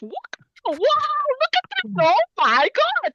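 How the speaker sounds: delighted. Real, not acted. Watching Blippi clip, delighted.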